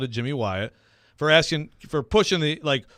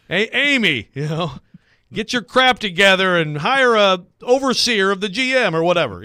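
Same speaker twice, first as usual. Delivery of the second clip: mock demand; 6 semitones higher, 7 dB louder than usual. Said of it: "It's like".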